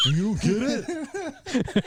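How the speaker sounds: high, very drawn-out indignant question; milk it at an audience that won't laugh; mock mad comedian. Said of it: "I'm, like, mad that you're not laughing".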